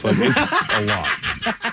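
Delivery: slow, laughing through the words. Real, not acted. Telling the host about married life, laughing. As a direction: drag it out while cracking up. Laughing at his own sex joke.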